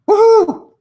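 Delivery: high, loud celebratory cheer; excited whoop. Real, not acted. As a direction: let out a big woohoo. Celebrating the end of reading.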